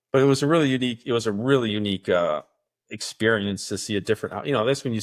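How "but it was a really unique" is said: usual speaking voice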